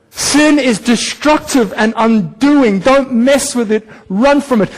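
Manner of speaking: slow, deliberate repeat; a preacher driving the point home; slow emphatic warning. Real, not acted. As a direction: lean into it slowly, word by word. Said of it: "repeated warning".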